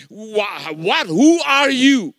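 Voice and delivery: slow, imitated incredulous cry; astonished demand. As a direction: act out her loud, amazed demand. Retold woman demanding his identity.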